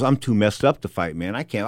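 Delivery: low, soft worn-out boxer; messed-up refusal. Retold or imitated. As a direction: mumble a feeble, low excuse.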